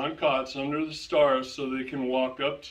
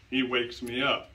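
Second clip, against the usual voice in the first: normal talking voice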